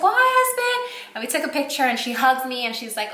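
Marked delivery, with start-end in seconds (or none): 0.0-0.8 bright, much higher squeal of hello; excited high greeting. Retold or imitated. YouTuber greets the husband, squealing with delight. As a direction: gush a delighted hi.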